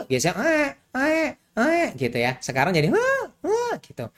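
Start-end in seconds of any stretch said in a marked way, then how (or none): 0.4-1.9 a high-pitched mock infant wail; high baby cry imitation. Shown as a demonstration. Pediatrician imitating a colicky baby's high-pitched cry. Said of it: "high pitch, jadi nadanya". 2.9-3.7 a much higher mock infant wail; higher baby cry imitation. Shown as a demonstration. Pediatrician showing the higher colic cry to parents. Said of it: "Itu lebih tinggi dan bervariasi dalam nada".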